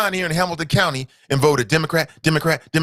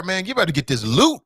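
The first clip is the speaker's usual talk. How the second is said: ordinary delivery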